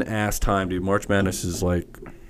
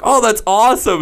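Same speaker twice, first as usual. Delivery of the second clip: excited passengers; 10 semitones higher, 5 dB louder than usual. Retold or imitated. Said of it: "everyone was like".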